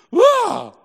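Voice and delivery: sudden high loud mock wail of panic; panicked wail. Pastor mimicking a panicking believer, high loud wail. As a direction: act out a frightened outburst, shrill and loud.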